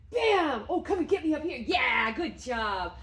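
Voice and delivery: bursting, high, loud praise for the dog; squealing delighted dog praise; excited praise. Real, not acted. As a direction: cheer him on like he just won. Praising dog after jump.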